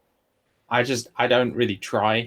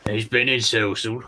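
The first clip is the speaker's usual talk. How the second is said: a touch of Cockney, louder; lightly voiced Cockney; Cockney-flavoured line, drops the r; Cockney accent; 4 dB louder than usual. Shown as a demonstration. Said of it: "How can you say it in a Cockney accent".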